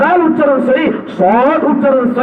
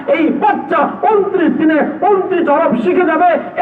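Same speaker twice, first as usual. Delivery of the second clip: preaching at full force; 6 semitones higher than usual. Acted. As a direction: thunder it out to the congregation. (জোরালো ওয়াজের সুরে)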